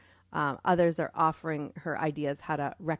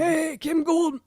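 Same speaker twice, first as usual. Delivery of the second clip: put-on slow, louder New York old guy greeting; hammed-up New York accent, higher; 9 semitones higher, 6 dB louder, slower than usual.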